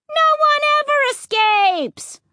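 very high, piercing creature shout; shouted threat. A narrator's reading. Another pursuing horror shouts very high at the family. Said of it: "another horror shouted".

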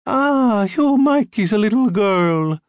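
put-on old Italian mother, high and loud; heavy Italian accent. A narrator's reading. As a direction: greet her in a thick Italian accent. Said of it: "She had a heavy Italian accent".

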